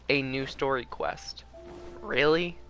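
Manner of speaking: normal talking voice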